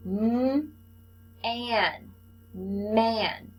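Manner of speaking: "M", "an", "man" said one sound at a time, then blended; sounding out syllables. Shown as a demonstration. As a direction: build "man" sound by sound for a child. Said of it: "our next syllable".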